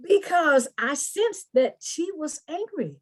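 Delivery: normal talking voice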